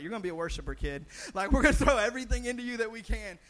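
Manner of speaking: put-on loud, eager pep talk to a toddler; excited cheer to a child. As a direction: hype up the little worshiper, full voice. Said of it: "so we're like".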